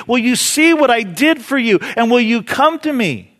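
high, loud, urgent plea; crying out. Acted. Jesus asking you to come to him, crying out. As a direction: preach it as an impassioned appeal. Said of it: "That very cry. He says".

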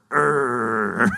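low, drawn-out groan; wordless groan. Shown as a demonstration. Preacher shows a prayer without words, groaning. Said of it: "It's a groan".

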